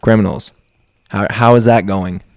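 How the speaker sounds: ordinary talk, nothing marked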